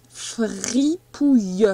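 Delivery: "Fripouille" very slowly, stretched syllable by syllable, fri-pouille; slow sounding-out. Shown as a demonstration. Teacher dictates a word to a pupil, "Fripouille" slowly sounded out.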